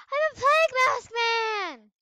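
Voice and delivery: gasp, then a high, loud cry of delight; thrilled yell over a new costume; excited shout. Real, not acted. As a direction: shout it like a surprise reveal.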